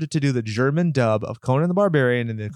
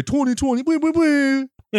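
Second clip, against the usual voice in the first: silly high singsong; 12 semitones higher, faster than usual. Retold or imitated. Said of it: "then you say".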